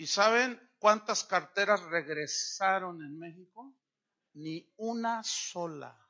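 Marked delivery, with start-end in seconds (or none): 4.3-6.0 "Ni una sola" drawn out slowly, word by word; emphatic punchline. Real, not acted. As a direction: stretch "Ni una sola" for effect. Punchline count for his own country.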